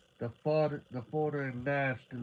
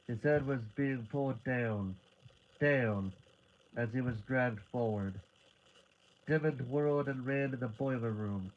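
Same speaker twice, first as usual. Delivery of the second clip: ordinary delivery, nothing marked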